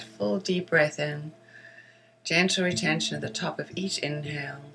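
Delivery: measured meditation guiding with long pauses for breathing; guided meditation. Acted. Teacher guiding listeners through breath and silvery-light visualization, guided meditation voice. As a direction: lead listeners gently inward, leaving space after each phrase.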